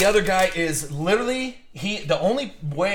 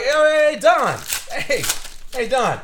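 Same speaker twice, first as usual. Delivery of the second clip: loud, much higher call across the yard; 8 semitones higher, 5 dB louder, slower than usual. Retold or imitated. Calling the retired neighbor over from outside, loud and much higher. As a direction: hail the neighbor from a distance.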